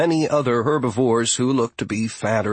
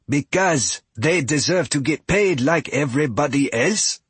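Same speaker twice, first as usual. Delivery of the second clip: growled; 5 semitones higher than usual. A narrator's reading. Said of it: "Fia growled".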